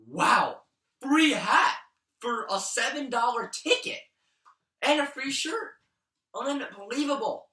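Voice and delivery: high, loud, delighted; thrilled. Real, not acted. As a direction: gush over the free hat. Thrilled over free hat.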